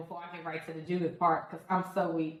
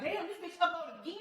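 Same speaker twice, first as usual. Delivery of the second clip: mock surprise; 10 semitones higher, 5 dB quieter than usual. Voices viewers' surprised complaint.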